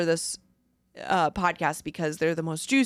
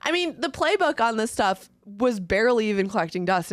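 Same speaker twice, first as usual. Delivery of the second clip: usual speaking voice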